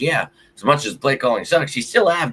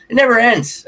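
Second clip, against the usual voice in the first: it never ends, much higher and louder, thrown out as a cry; exasperated cry; 12 semitones higher, 6 dB louder than usual. Real, not acted. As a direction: howl it never ends at the sky. Repeating the phrase, rising then settling.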